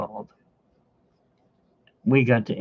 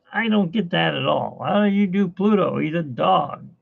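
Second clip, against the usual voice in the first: usual speaking voice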